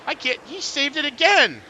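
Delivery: shocked live race call, rising loud; sudden disbelief at a spin; excited. Real, not acted. Driver spins during live race.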